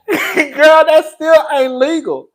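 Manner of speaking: laughing through the words. Real, not acted. Streamer cracking up at Suki's claim, laughing. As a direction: let the laugh break through.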